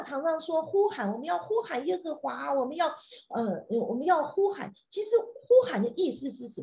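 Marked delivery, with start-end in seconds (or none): none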